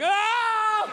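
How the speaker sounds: short loud yelp out of nowhere; sudden shout. Retold or imitated. Comic driving alone, shouting a bad memory away, loud yelp. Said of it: "shout the memory out".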